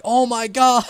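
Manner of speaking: high, loud delighted gasp; excited squeal. Retold or imitated. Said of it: "you're like".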